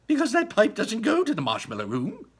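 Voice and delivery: high, loud chirpy explanation; high loud Wonka voice; Wonka explaining. Acted. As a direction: set her straight brightly. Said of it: "said Mister Wonka".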